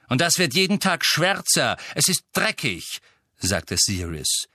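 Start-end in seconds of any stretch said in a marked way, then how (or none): 0.1-3.0 high, raised, cross retort; irritated rebuke. A narrator's reading. Master complaining the house is dirty, irritated. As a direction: snap back at the servile elf.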